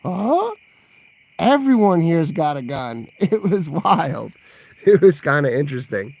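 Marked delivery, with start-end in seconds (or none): 0.0-0.5 sharp high rising huh; quick stunned huh; puzzled huh. Retold or imitated. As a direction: act baffled by the sight. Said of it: "And I'm like".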